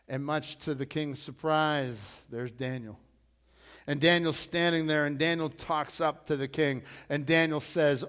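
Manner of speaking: usual speaking voice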